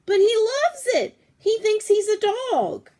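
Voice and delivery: put-on high, loud kid whine; high pleading protest. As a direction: beg dad, pitch way up. Pleading with dad.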